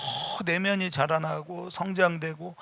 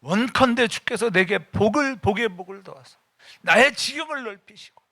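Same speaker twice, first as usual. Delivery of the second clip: fervent prayer building; 6 semitones higher, 4 dB louder than usual. Acted. Jabez prayer, pleading up to a shout. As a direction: pray it rising to a cry at the end. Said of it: "야베스 기도".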